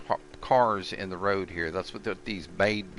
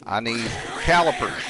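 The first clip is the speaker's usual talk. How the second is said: shouting; 3 semitones higher, 7 dB louder than usual. Pretend. Repeated calling for calipers.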